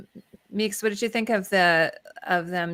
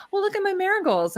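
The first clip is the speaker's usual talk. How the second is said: high-pitched mock dubbed actress; imitated English dub; 11 semitones higher than usual. Mocking the film's awful English dub, high imitated actress. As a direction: send the voice way up like a bad dub. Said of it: "with the dub over in English".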